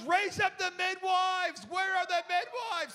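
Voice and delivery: loud, high, slow summons; loud high commanding shout. Retold or imitated. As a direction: call the midwives like a field commander. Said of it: "Jill goes".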